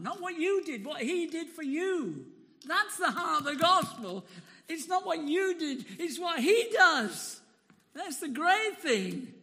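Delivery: loud, rising gospel proclamation; full-force preaching. Real, not acted. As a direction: preach it out to the whole room.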